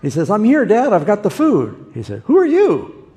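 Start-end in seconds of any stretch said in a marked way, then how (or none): 0.3-1.9 loud, high announcement to Dad; eager arrival. Retold or imitated. Son arriving with food for his blind father, eager loud call. 2.3-3.1 loud, very high astonished question; startled demand. Retold or imitated. Blind father to the second son arriving, startled high cry.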